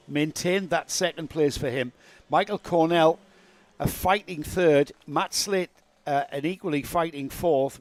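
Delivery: upbeat results rundown; sports commentary. Acted.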